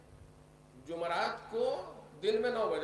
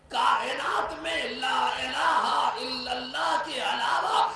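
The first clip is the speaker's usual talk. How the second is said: slow, weighty, louder pulpit voice; slow forceful preaching; 3 semitones higher, 4 dB louder, slower than usual. Acted. Preacher stressing the kalima to the gathering, slow and weighty. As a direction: lean on every word of it.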